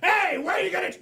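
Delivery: hammed-up kid's angry yell. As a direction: shout it high, acting outraged. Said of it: "the kids started yelling".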